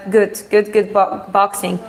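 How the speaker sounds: usual speaking voice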